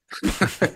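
short laugh. Real, not acted. Jokes about bullet holes.

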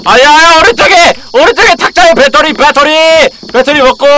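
high, loud rapid calling out; urgent excited calling. Real, not acted. Streamer urging his partner to grab the battery, urgent calling out. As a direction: call it out before he misses it. Urgent item pickup in game.

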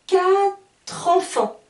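slow, loud quatre enfants linked as katr-enfants; slow, emphatic model of the liaison. Shown as a demonstration. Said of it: "Je regroupe les deux mots".

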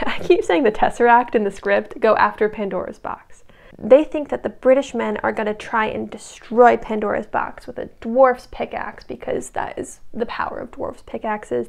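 plain reading voice

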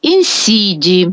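інсіді slowly, stressed on СІ, short last І; slow інсіді with the stress before the last syllable; unstressed final І. Shown as a demonstration. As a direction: lean on СІ in інсіді, let ДІ drop. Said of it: "буде ненаголошений".

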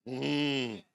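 ordinary delivery

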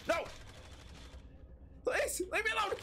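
very high pleading squeal; mock frightened squeal. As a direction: plead then shriek in mock panic. Fleeing enemy in game.

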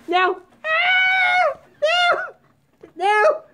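very high shriek of protest; panicked scream. Real, not acted. Owner as the cat charges through, panicked scream.

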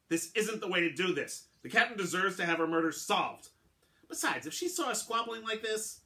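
high, loud urgent pleading; sailing master stepping between two men, urgent and loud; urgent plea. Acted. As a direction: plead hard to calm the fight.

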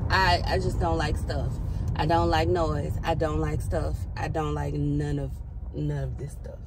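usual speaking voice

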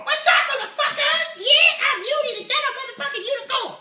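swaggering high puppet voice; brash puppet unicorn. Acted. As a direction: play a loud foul-mouthed puppet. Puppet voice Uni.

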